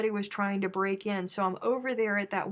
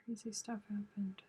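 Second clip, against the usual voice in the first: hushed, secretive whisper; playful whispered aside; 11 dB quieter than usual. Acted.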